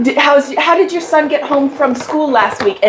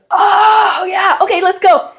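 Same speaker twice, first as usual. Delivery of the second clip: sudden realization shout; 8 semitones higher, slower than usual. Retold or imitated. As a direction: blurt it out, suddenly catching on. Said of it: "and he goes".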